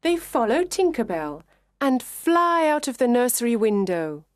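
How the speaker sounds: usual speaking voice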